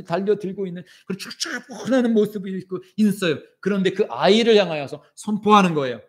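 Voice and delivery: impassioned sermon, rising and falling; preaching. Acted. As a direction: preach it with fervor to the congregation. (열정적인 설교)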